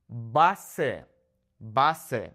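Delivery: Base said BAH-seh, twice with a pause; Spanish "Base" in two open syllables; Spanish pronunciation. Shown as a demonstration. Teacher models the vowels, Spanish Base as BAH-seh. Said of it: "changing the way I'm pronouncing the vowels".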